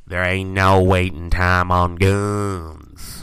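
slow, drawn-out Southern drawl; Southern accent. Acted. Joking about gun lovers in southern Minnesota, Southern drawl. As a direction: put on a slow Southern twang. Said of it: "stuck in this goddamn accent".

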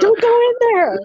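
high-pitched playful cry of warning; hammed-up alarmed plea; mock horror-movie warning. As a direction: call out to the doomed character on screen. Joins in screaming at horror movie.

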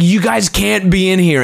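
mild, high-pitched mock yell; retold yell. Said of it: "yells at us".

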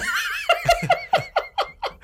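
airy, high wheezing cackle; wheezy old man laugh. Acted. Friend laughing at his own joke. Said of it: "getting old laugh".

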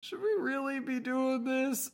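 high, uneasy voicing of a doubter; hesitant doubt. Recapper quotes a character unsure about the plan, hesitant.

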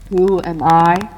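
slow, loud, cold as stone; hard as iron, menacing spirit. Acted. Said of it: "as cold as stone and hard as iron".

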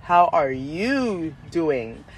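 very slow "How are you doing", with "you" stretched long; drawn-out variant. Shown as a demonstration. Showing different ways of saying things, drawn-out "you". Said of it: "different ways of saying things".

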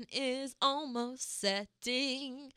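slow, drawn-out showy announcement; hammed-up, slowly unfurled title line; dramatic show tagline. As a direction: stretch every word out for mock grandeur. Dramatic sign-off bit with show title.

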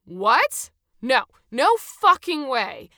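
loud, outraged refusal; heated. A narrator's reading. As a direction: refuse point-blank, slow and very loud. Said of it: "began to get a little heated".

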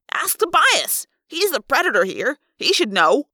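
scared, frustrated jab at Tobias; mean jab. A narrator's reading. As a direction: snap it at him to hurt. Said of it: "I meant it to be mean".

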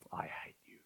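breathy whisper of contempt; rebellious child whispering to his father; cold whispered rejection. Acted. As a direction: whisper it coldly, turning away. Judah voiced rejecting father.

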